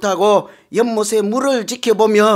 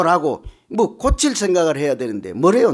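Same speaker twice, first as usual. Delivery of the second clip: ordinary talk, nothing marked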